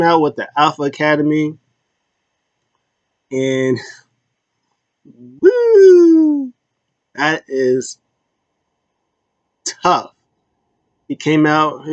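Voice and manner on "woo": high loud whooping laugh; laughing woo. Real, not acted. Vlogger cracking up over Chad Gable, loud whooping laugh. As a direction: let out a big laughing woo.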